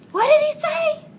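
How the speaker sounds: shocked frogs blurt it out high; frogs crying out. Acted. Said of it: "cried the frogs".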